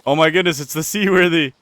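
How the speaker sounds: loud, high excited shout. Real, not acted. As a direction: yell it out, thrilled. Spots the ship, shouts excitedly.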